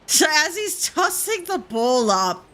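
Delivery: usual speaking voice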